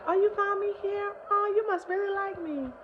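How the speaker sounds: put-on very high girly flattered tone; high sweet naive voice; flattered girl voice. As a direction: coo like a flattered teen. Younger self flattered.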